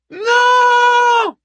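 long, high shouted refusal; crowd yell. A narrator's reading. The women on the ramparts answer the speaker, yelling. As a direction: roar it back like a thousand women. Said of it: "hurlèrent le millier de femmes".

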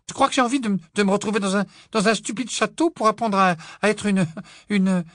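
very high, loud, stumbling scorn; spiteful outburst. A narrator's reading. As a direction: spit out the contempt, stammering. (Pétunia méprisante, criarde, bégaie de rage)

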